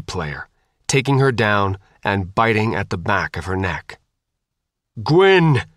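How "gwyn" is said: high, loud cry of alarm; alarmed yell. A narrator's reading. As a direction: yell out to someone under attack. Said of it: "Chris yelled".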